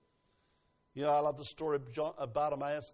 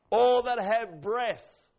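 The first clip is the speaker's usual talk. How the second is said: loud, slow, drawn-out declaration; proclaiming; 7 semitones higher, 10 dB louder, much slower than usual. Real, not acted. Preacher quoting scripture to the congregation, proclaimed. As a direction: ring it out as a command to all.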